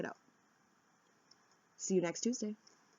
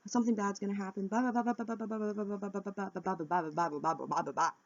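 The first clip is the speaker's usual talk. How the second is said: mock racing panic chatter; 2 semitones higher, faster than usual. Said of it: "I would be telling myself".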